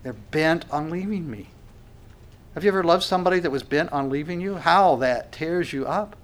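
ordinary delivery

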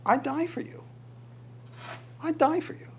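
mock devoted vow. Fiance vowing to the woman, in a high mock swoon. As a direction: swoon it like a melodramatic suitor. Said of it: "what the woman is wanting to hear".

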